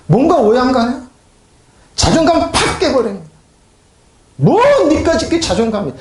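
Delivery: high, heated pulpit delivery; forceful preaching. Acted. Preacher mimics critics crushing self-esteem, forceful and high. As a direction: preach it hard, rising in pitch.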